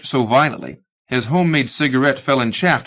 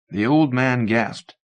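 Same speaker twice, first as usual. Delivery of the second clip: plain reading voice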